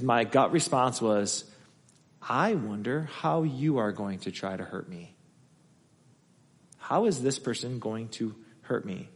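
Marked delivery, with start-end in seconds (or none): 2.2-9.1 quiet fearful thought. Retold or imitated. Retold fearful inner thought.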